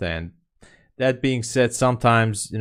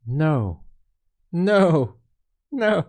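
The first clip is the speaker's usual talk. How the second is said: each no pitched higher, a mounting wail; rising despair; 8 semitones higher, 3 dB louder, much slower than usual. Real, not acted. Trader begging the price to stop, rising wail. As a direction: plead with the chart, climbing higher. Price spikes against his position.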